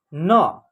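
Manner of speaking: न sounded alone with its full inherent vowel; single-letter sounding. Shown as a demonstration. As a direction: sound out न as a separate syllable. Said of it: "एक एक अक्षर का उच्चारण करेंगे".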